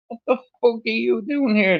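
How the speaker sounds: high-pitched, disbelieving question; acting out startled disbelief; retold incredulity. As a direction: demand an explanation in a high voice. Retold shock at unexpected visitor.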